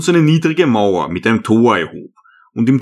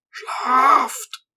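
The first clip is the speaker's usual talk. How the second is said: put-on much higher, soft old man's word; old hermit; 13 semitones higher, 4 dB quieter than usual. A narrator's reading. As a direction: tell them gently to sleep. Hermit tells them to sleep.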